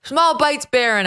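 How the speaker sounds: high, loud singsong mum, dropping on the name; imitating Melania. Host quotes mother telling son at dinner, high singsong imitation. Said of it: "Trump and Melania".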